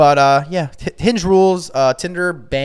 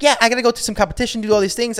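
higher-pitched, hurried quote of someone else; retold dodging excuse; 8 semitones higher than usual. Retelling a date's excuses for dodging him, lightly imitated. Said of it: "she's like".

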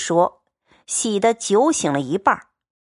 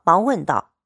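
usual speaking voice

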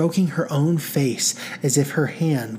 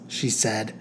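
usual speaking voice

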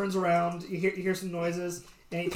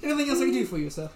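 bartender voice; 6 semitones higher, 5 dB louder, faster than usual. Acted.

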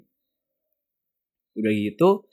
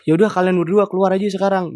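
imitated lecturer, louder and higher; mock exasperated dismissal; 7 semitones higher, 5 dB louder than usual. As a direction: send them out in a retold huff. Lecturer sends both students out.

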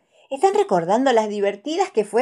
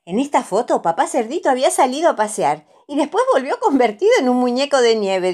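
fast, amused, higher storytelling; 6 semitones higher, 5 dB louder than usual. A narrator's reading. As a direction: tell it quickly, tickled by the joke. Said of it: "dice Mamá Cerdita muy divertida".

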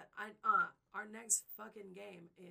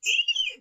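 squeal; 22 dB louder than usual. Real, not acted.

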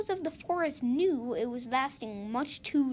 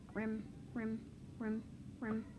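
very soft nervous sound; 3 semitones lower, 12 dB quieter than usual. Acted. Monkey nervously glancing about.

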